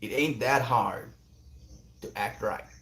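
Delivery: second-rate Texan impression, unhurried; attempted Texan accent. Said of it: "Maybe that's closer".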